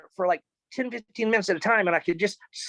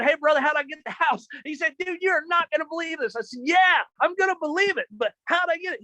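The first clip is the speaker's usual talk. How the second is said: eager; 8 semitones higher than usual. Retold or imitated. Eager call with realtor.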